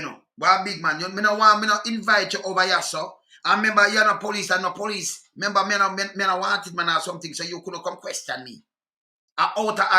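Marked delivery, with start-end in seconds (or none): none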